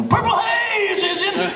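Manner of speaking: high, slow hammed-up rock yell; mock rock-star shout. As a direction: belt it like a heavy rock frontman. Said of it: "you're expecting to have him go".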